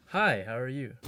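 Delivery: a changed, punchier take of the greeting; third way. Shown as a demonstration. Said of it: "say the same thing three different ways".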